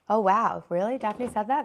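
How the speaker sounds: ordinary talk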